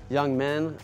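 ordinary delivery

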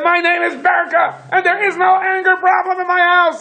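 very high-pitched imitated yell; mock screaming. Angry caller named Berka denies an anger problem, mock screaming. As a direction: scream it at the top of your voice, hammed up. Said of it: "screaming at the top of his lungs".

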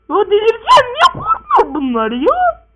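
high, loud exasperated wail; frustrated outburst. Acted. Boy failing to scare his family, loud frustration.

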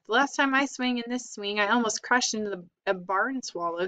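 ordinary delivery

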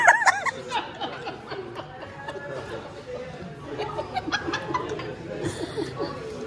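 long high squealing laugh. Real, not acted. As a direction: burst into a shrieking laugh. Big laugh at the table.

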